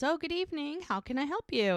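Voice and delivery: high, lilting hostess greeting; welcoming greeting. Retold or imitated. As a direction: voice an innkeeper welcoming a guest. Innkeeper greets a guest, acted.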